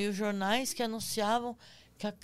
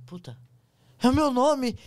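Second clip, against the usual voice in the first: startled shout; 4 semitones higher, 12 dB louder than usual. Retold or imitated. Hears name in the dark.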